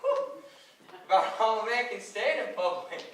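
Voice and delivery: ordinary talk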